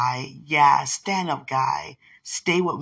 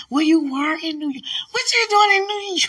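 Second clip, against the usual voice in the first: gushing squeal; 12 semitones higher, 4 dB louder than usual. Real, not acted. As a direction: coo over her like a proud mother. Gushing at the photo.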